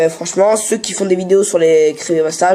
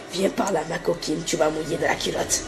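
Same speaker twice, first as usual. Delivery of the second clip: mock seductive; 2 semitones lower, 10 dB quieter than usual. YouTuber jokingly coming on to Amy, mock seductive. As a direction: drop right down into a hushed, teasing come-on.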